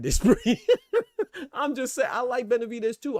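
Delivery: breaking up into high laughter; laughing. Real, not acted. Joking, breaks up laughing mid-remark.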